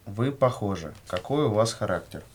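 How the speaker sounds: ordinary delivery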